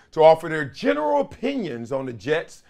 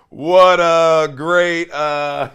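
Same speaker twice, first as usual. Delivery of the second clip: louder, slow drawn-out voice; Bruce Smith impression; 7 dB louder, much slower than usual. Host imitating his football father with a slow loud voice. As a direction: stretch the words like an old teammate. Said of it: "let me do my Bruce Smith voice".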